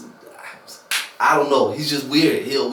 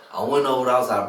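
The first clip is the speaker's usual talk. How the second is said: normal talking voice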